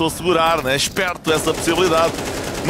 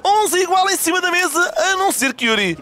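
high-pitched shouting caster call; excited play-by-play; 11 semitones higher than usual. Real, not acted. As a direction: scream the clutch moment. Climax of a round call.

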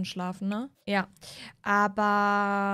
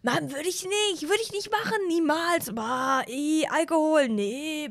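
put-on higher, whiny drunk denial; tipsy son denying to Mom, pitched up; 5 semitones higher, slower than usual. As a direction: deny it like a caught teen.

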